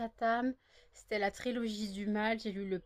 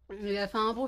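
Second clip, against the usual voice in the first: normal talking voice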